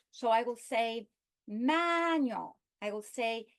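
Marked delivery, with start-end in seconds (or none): 1.5-2.6 MAN in "manual" stretched and lifted; stress on first syllable. Shown as a demonstration. Teacher giving a noun example, MAN stressed in "manual". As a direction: lean long on the start of "manual". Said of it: "on the first syllable".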